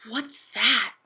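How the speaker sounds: hushed whisper under the covers; frightened little whisper. A narrator's reading. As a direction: breathe the question, barely voiced.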